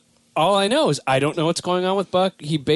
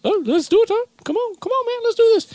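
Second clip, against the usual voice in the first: much higher, hyped-up buddy voice; high excited buddy impression; Buck Perez voice. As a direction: pitch way up like a pumped-up pal. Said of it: "whenever I do the Buck Perez voice".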